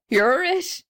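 high-pitched groaned answer; groaning. A narrator's reading. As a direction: groan it out in dismay. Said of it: "he answered with a groan".

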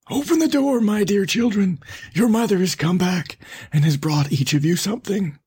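high, put-on hoarse wolf call; hoarse wolf posing as mother. A narrator's reading. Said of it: "by the hoarse voice".

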